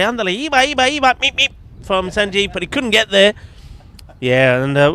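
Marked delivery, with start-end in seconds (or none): 0.0-3.3 higher, louder, cheering the batsman on; rising to the moment as he runs; urging Sanjeev on from the boundary, loud and excited; excited commentary. Real, not acted. Run attempt, live commentary.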